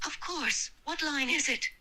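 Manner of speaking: nervous, high-pitched reply; squeaky-high, eager nerves; really high-pitched. Acted. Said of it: "you got really high pitched there".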